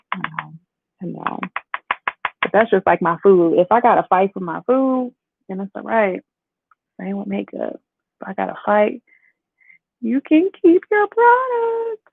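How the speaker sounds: normal talking voice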